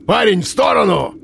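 high booming command. A narrator's reading. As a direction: boom the order from above. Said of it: "зычный и до боли знакомый голос".

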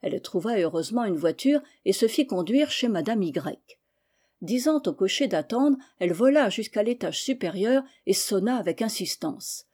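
usual speaking voice

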